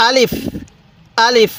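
brief, even আলিফ with no lengthening; unstretched আলিফ. Shown as a demonstration. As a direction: say আলিফ short, without drawing out either vowel. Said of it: "টান দিবেন না".

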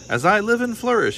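high, swooping cry of amazement; astonished and thrilled by a find; delighted exclamation. A narrator's reading. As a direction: exclaim grandly, pitch leaping up. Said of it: "he exclaimed".

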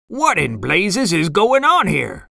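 Mister Krabs. A narrator's reading. Boss finding his restaurant mobbed, demanding. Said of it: "Mister Krabs demanded".

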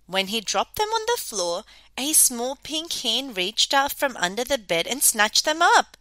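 plain reading voice

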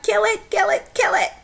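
imitating a panicked kid, high and urgent; frantic child plea. Frightened girl begs her father to kill the spider, high and frantic. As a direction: beg over and over for the spider to die. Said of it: "I pointed to the spider and I was like".

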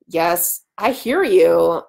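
plain reading voice

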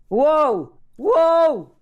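high, loud drawn-out whoop of amazement; big high-pitched wow; amazed wow. Real, not acted. Amazed outburst during the task.

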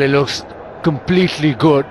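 ordinary delivery

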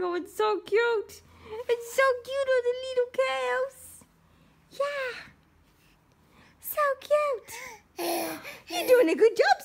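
high, loud gushing praise. Real, not acted. Praising baby's curls.

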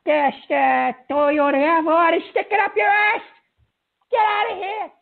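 loud furious shout; angry shout. Real, not acted. Host screaming at the caller to get out, enraged. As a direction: scream him off the line.

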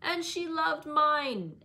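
high, loud, drawn-out jeer; childish spiteful crowing; gloating taunt. A narrator's reading. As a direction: stretch it out to needle her. Boy taunts cousin in quarrel.

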